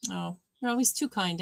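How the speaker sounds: ordinary talk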